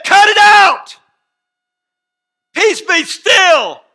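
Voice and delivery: high, full-force shouted command. Acted. Jesus rebuking the storm, shouting a command. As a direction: thunder it out with authority.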